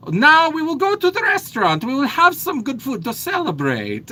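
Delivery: put-on high, loud, bubbly Italian accent. Host imitates her Italian friend Chiara, bubbly Italian accent. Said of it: "that's kind of how she talks".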